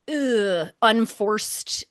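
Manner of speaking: ordinary talk, nothing marked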